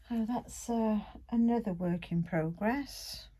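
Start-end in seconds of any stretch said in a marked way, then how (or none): none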